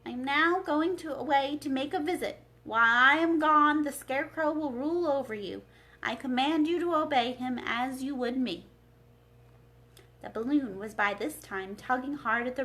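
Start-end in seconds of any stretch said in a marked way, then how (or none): 0.1-8.7 a wizard's booming farewell address; loud voice. Acted. Oz announces his departure to all the people, loud and commanding. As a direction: proclaim it loudly to the crowd, slowing at the start. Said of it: "said to all the people in a loud voice".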